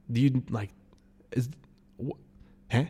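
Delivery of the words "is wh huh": ordinary delivery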